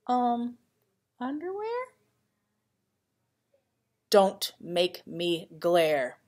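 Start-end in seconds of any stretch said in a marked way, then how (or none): none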